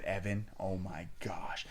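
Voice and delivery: retold shocked half-whisper; softly stunned, almost under the breath; hushed disbelief. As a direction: drop to a slow, hushed voice. Retelling friend's shocked reaction.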